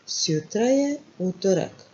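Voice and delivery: normal talking voice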